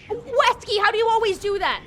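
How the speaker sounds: high, loud disbelieving yell; loud amazed shout at the teammate; shocked outcry. Real, not acted. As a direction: cry out in disbelief.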